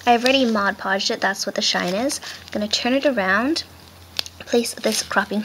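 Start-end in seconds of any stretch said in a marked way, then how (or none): none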